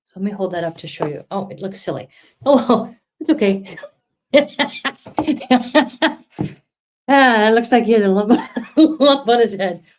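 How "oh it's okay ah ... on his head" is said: giggling through the words; laughing off a lumpy drawing. Real, not acted. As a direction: laugh at your own messy bear.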